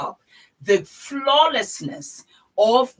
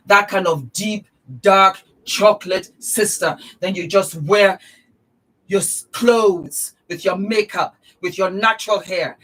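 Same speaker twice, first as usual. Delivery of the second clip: hyped up; 2 semitones higher, 7 dB louder than usual. Real, not acted. Vlogger hyping tanned sisters, loud and gushing. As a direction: sell the look with big enthusiasm. Hyping the dark chocolate look.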